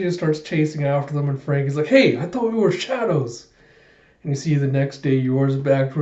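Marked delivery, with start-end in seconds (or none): 1.9-3.5 high-pitched indignant objection; mock protest. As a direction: call after them in acted dismay. Frankie protests at the chase.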